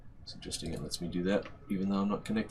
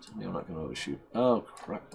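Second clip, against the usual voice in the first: normal talking voice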